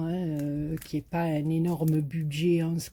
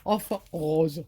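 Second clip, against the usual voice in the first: "House of Rose" in a light mock Marseille accent, "House" higher and louder; 4 semitones higher, 5 dB louder, slower than usual. Shown as a demonstration. Joking the brand name to viewers in a Marseille accent. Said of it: "à dire à la marseillaise".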